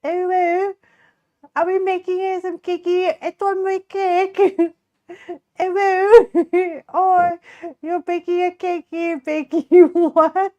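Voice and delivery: high-pitched pretend British child, breaking into laughs; British child impression. Streamer hamming up the failed French accent, British child voice. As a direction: play a cutesy little British child, well above your own pitch. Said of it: "Proceeds to be a British child".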